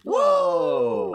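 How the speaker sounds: high, loud, drawn-out whoa; excited whoa. Real, not acted. Player cheering the final roll, excited whoa. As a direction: belt out a long high whoa.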